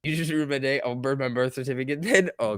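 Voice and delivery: normal talking voice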